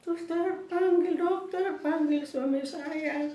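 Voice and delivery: high, slow, urgent calling; re-enacted drawn-out yell; shouting. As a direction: cry out for someone to fetch help. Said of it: "Saya berteriak".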